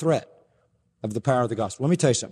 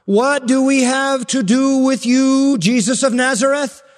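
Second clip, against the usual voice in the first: shrill demon pleading, higher and louder; panicked demon voice; 10 semitones higher, 5 dB louder than usual. Acted. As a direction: shout it out like a terrified demon. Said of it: "the demon said out loud".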